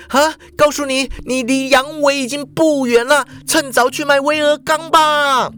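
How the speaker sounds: high, mocking girl's voice; high scornful yelling; shouting taunts. A narrator's reading. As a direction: taunt him as he runs. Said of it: "淑华就大叫说".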